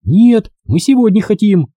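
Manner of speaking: put-on high, stubborn girl's protest; stubborn insistence. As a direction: refuse firmly, voice up.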